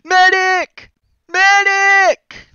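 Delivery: mock shout for a medic, high; hammed-up battlefield shout; man down shout. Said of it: "They're larping".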